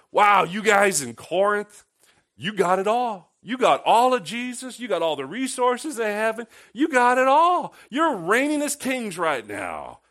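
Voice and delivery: over-the-top sarcastic praise to the Corinthians. Acted. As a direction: gush with fake wonder at their riches. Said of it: "now this is all sarcasm".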